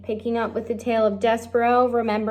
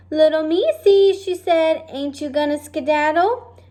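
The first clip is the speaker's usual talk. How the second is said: put-on cooing girl; 7 semitones higher, 4 dB louder than usual.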